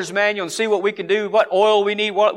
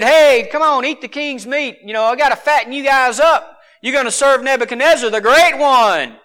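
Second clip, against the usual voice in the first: loud, higher hearty coaxing; higher, louder mock command; cajoling official; 6 semitones higher, 5 dB louder than usual. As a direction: play a pushy royal host, building to a grand finish. Acting out the order to Daniel.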